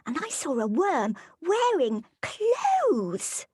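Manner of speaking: put-on high excited child, very slow stretched ending; child telling mom in a high voice. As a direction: gush like a wide-eyed kid to mom. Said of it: "playing the child here".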